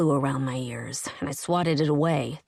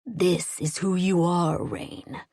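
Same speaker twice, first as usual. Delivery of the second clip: deep husky voice; slower than usual. A narrator's reading. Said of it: "Kaz's deep, husky voice".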